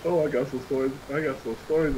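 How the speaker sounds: loud, tickled, breaking into a laugh; amused. Real, not acted. Streamer promising stories about a friend, amused and laughing. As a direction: tease a funny story, then crack up. Cracking up before telling stories.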